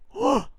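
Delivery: high, sharp gasp of recognition; acted gasp of astonishment; startled gasp. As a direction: gasp as if suddenly recognising someone. Acts out Mary's sudden recognition.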